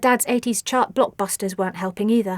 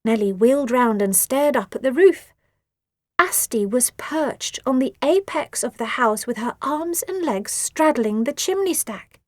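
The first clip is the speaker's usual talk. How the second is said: ordinary delivery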